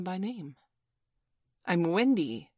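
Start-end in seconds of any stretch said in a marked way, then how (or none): 1.7-2.5 louder, mildly agitated outburst. A narrator's reading. Wendy reminding Peter who she is, agitated. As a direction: say it with a touch of agitation. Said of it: "she said agitatedly".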